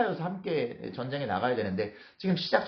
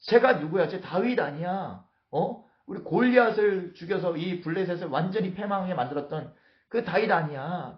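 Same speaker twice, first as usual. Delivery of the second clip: startled commotion; 6 semitones higher than usual. Acted. Philistine lords recognizing their old enemy, startled commotion. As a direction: challenge it in acted disbelief. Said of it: "그들이 막 놀라기 시작하는".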